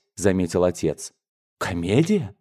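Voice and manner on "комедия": outraged, higher-pitched rebuke; scandalised outburst; indignant shout. A narrator's reading. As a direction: build from disbelief to a high, angry cry. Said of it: "крикнул дядя".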